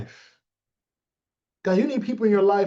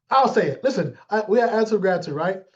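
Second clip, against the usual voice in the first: usual speaking voice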